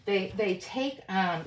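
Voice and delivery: normal talking voice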